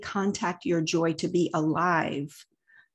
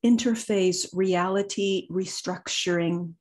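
guided meditation. Acted. Leads a meditation, long pauses.

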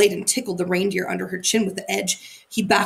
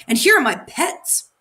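higher and louder announcement; announcing; 6 semitones higher, 4 dB louder than usual. A narrator's reading. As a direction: show off the pets proudly. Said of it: "she announced".

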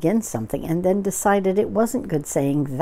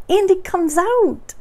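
very high, slow, loud little voice; excited Piglet; 13 semitones higher, 6 dB louder than usual. Acted. Piglet watches the balloon come out, squeaky and excited. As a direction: squeak it out in drawn-out wonder. Piglet echoes Pooh, much higher.

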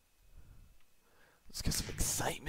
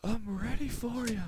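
excited taunt; 10 semitones higher, 6 dB louder than usual. Real, not acted. Taunting opponents in game.

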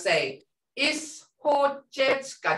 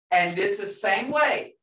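usual speaking voice

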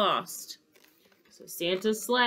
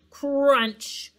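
high, punched storybook sound effect; crash word; 7 semitones higher, 6 dB louder than usual. A narrator's reading. Sleigh hits something in the clouds, sudden high exclamation.

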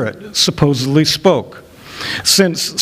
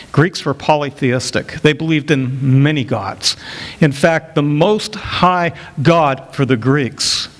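ordinary talk, nothing marked

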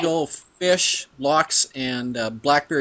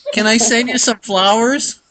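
Philip impersonation; 9 semitones higher, 3 dB louder than usual. Pretend. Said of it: "the best Philip impersonation".

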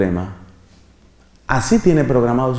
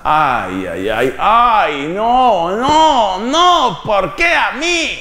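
wailing ay ay ay, climbing higher into a desperate no, no, no; mock despair; 6 semitones higher, much slower than usual. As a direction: act out the dismay of an unwanted pregnancy, pitch rising with each no. Woman learns she's pregnant.

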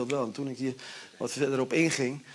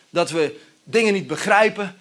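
ordinary delivery

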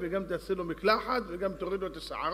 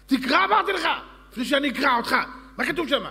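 much higher, much louder royal yelling; furious king; 10 semitones higher, 10 dB louder, faster than usual. Acted. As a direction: scream at the fumbling servant. King yells again at servant. (צעקת מלך זועם)

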